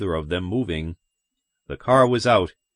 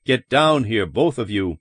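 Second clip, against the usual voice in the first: usual speaking voice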